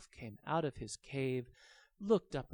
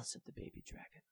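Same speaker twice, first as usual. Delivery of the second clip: plain reading voice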